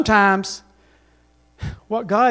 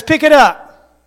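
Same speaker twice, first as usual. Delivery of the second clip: commanding voice of God; 5 semitones higher, 6 dB louder, slower than usual. Acted. Voicing God's command.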